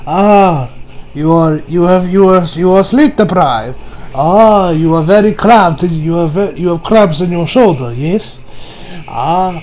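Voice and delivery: imitated Italian chef accent. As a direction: give a diagnosis in a put-on Italian accent. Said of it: "I'm going to talk with an Italian accent".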